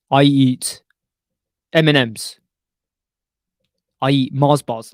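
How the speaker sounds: mock boast. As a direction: declare your snacks loudly like news. Mock announcing what he eats.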